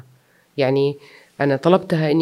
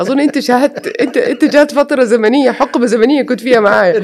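laughing; 9 semitones higher, 10 dB louder than usual. Real, not acted. Continues the joke laughing.

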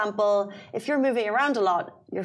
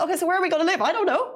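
higher, fretful voicing of a confused couple; a lightly voiced anxious partner, higher; mock-fretful quote; 8 semitones higher, much faster than usual. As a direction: act out the panicked question, pitched up. Acting out a couple's dilemma.

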